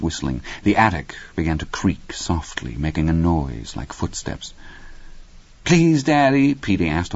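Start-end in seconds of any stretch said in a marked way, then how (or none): 5.6-6.5 put-on high little-boy begging; lightly voiced pleading child; private child's plea. A narrator's reading. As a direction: plead with Daddy, pitched up. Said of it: "only loud enough for his father to hear".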